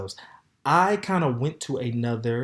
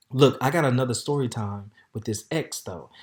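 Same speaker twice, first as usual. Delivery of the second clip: ordinary delivery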